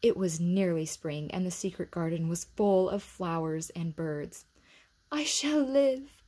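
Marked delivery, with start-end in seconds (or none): 5.1-6.2 slow, high, loud entranced vow; whispered vow. A narrator's reading. Colin in the garden, entranced. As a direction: say it like waking under a spell. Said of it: "Colin whispered".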